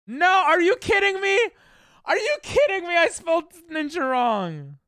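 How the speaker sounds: high, loud disbelieving shriek; outraged squeal. Real, not acted. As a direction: squeal in mock outrage at the mistake. Shocked at own typo.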